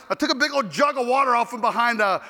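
ordinary talk

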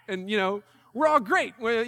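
usual speaking voice

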